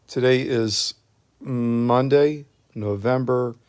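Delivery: ordinary talk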